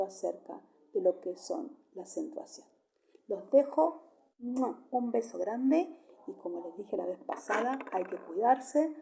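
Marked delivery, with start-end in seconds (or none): 4.4-4.8 air kiss. Real, not acted. Teacher sends kiss goodbye.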